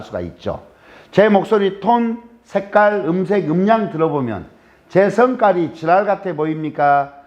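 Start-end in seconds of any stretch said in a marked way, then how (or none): none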